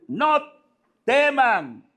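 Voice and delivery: high, measured proclamation; Jesus reassuring. Acted. Jesus to the frightened apostles, high reassuring proclamation. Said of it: "Y dice".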